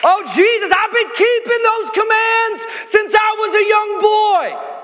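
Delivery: high, loud, slow mock boast; proud religious man. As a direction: mimic a self-satisfied believer. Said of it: "Classic religious response".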